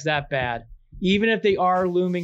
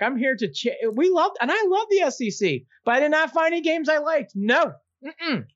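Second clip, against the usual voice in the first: excited defence; 10 semitones higher than usual. Real, not acted.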